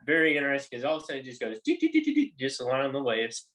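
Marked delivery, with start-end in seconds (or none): 1.6-2.3 high, bouncy doo-doo sounds; high sing-song doo doo. Retold or imitated. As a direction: sing the bobbing lure noise. Imitating lure skipping on waves.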